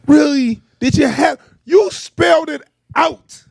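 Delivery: high, deliberate, pointed complaint; indignant scolding, word by word; exasperated disbelief. Real, not acted. Mock outrage at trailer spelling it out.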